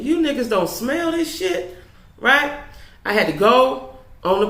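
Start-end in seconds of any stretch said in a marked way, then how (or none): 0.0-2.6 incredulous thought. Retold or imitated. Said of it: "I'm thinking".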